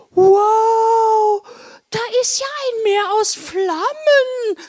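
very high, stretched-out wow; awestruck Blodi. Acted. Blodi sees a sea of flames, awestruck. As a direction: gape aloud in a squeaky character voice. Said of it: "sagte Blodi und erstarrte".

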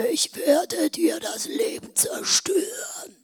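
slow, breathy menacing whisper; eerie threatening whisper. Retold or imitated. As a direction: hiss the threat slowly. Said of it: "Sie sagte".

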